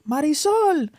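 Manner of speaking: imitating mom calling her kid in from outside. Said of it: "my mom was like".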